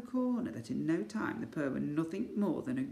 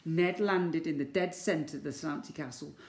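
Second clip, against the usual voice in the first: usual speaking voice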